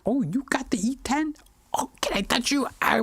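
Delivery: high, breathless whisper of envy; mock-awed whisper. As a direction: whisper it like you're starstruck. Mock awe at camera.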